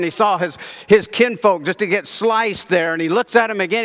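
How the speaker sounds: normal talking voice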